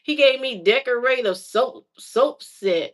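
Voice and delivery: ordinary delivery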